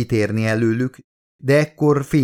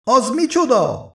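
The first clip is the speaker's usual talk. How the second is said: high, frightened cry of a question; frightened scream; 14 semitones higher than usual. A narrator's reading. Elizabeth seeing the strange light, frightened high cry. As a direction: shriek it out in sudden fear. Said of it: "sikoltotta most már rémült hangon Elizabeth".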